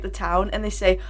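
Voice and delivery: ordinary talk, nothing marked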